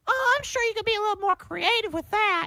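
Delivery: high-pitched singsong teacher imitation; art teacher. Teacher commenting on a student's plain journal, high mock-sweet voice. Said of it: "She was like".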